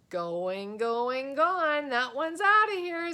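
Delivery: boy playing sports announcer; announcer call. A narrator's reading. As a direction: call it like a home run. Sportscaster-style home run call.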